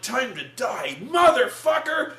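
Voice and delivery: action one-liner rising to a high shout; heroine's kill line. Retold or imitated. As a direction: build to a loud, drawn-out mock curse. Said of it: "she's like".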